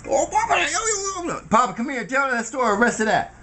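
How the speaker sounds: high shout calling Papa over. Retold or imitated. Grandson hollering for Papa across the room. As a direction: holler for Papa to come tell the story.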